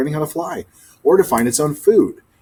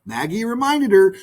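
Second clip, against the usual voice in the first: ordinary delivery, nothing marked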